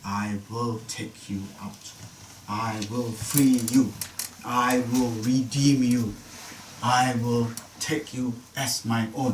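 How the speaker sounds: very slow, weighty promises; slow solemn declaration, pausing after each one; emphatic and deliberate. Acted. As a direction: spell out each promise word by word. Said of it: "This is important, get this right".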